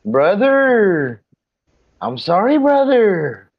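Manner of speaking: loud, high, drawn-out mock apology; Cam Newton impression. Host hamming up an apology over screen sharing, Cam Newton impression. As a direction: swoop up high and stretch out the brother. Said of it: "Am I Cam Newton?".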